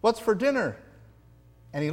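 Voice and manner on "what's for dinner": lightly voiced cheery husband, higher; mock sitcom husband calling out; husband coming home. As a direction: play the radio-ad husband breezing in.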